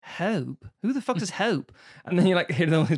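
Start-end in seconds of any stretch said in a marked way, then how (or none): 0.0-0.7 HOPE with an odd regional vowel; regional pronunciation of hope. Retold or imitated. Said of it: "in the South where you say". 0.8-1.7 mock bafflement. Mock baffled reaction to pronunciation.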